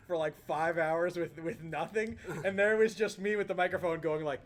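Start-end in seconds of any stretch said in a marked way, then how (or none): none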